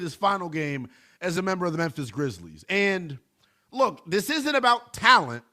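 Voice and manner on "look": ordinary talk, nothing marked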